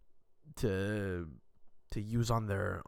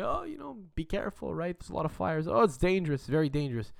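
worried grandparents; 7 semitones higher than usual. Retold or imitated. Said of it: "They just say".

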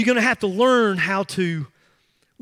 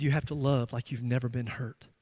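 quiet calm reply; 7 semitones lower, 10 dB quieter than usual. Retold or imitated. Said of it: "And he said".

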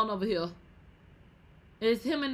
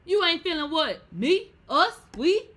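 higher and louder each word; rising indignation; 7 semitones higher, 9 dB louder than usual. Acted. Host mocking the quitting parent, building to a high loud peak. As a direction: build the outrage up. Ranting at parents who leave.

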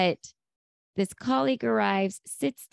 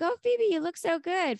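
high, gushing imitation; gushing compliment; 9 semitones higher than usual. Monica greeting Phoebe, imitated gushing. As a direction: gush in a much higher voice.

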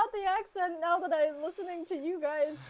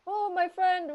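ordinary delivery

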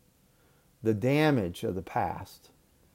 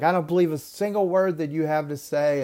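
higher, louder mock yell of an angry woman; retold yelling; 7 semitones higher, 5 dB louder than usual. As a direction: throw it at him like an accusation. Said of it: "she starts yelling at me".

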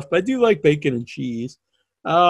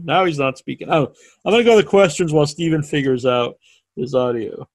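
ordinary talk

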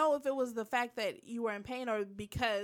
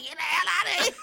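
imitated old grouch's raised yell; grumpy old man; 5 semitones higher, 4 dB louder, much faster than usual. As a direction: yell it like a crotchety lawn-guarding old man. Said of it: "the old grumpy man".

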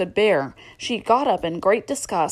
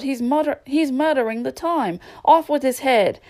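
mild bawl; 6 semitones higher than usual. A narrator's reading. Hatter quoting the outraged Queen, mildly bawled. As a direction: read a mock outcry, pitched up. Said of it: "bawled out".

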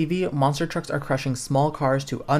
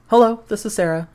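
put-on higher, louder young girl answering; younger girl voice; 7 semitones higher, 7 dB louder than usual. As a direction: sound like a young girl picking up. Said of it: "a younger voice answers".

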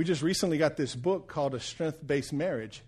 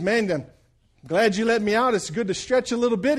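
genie voiced higher and louder; a freed genie stretching, pleased; relieved genie; 6 semitones higher, 6 dB louder than usual. Preacher voices the genie in his joke, higher and louder.

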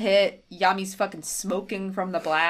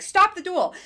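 high, louder demanding shout; imitated yell; 11 semitones higher, 5 dB louder than usual. Taya yelling at Kaiba, imitated high shout. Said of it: "she starts yelling at Kaiba".

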